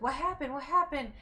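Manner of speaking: retold anxious asking; imitated concerned mom. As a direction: lightly voice an alarmed mom. Mom finding her crying, retold.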